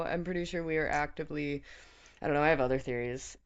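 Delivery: ordinary talk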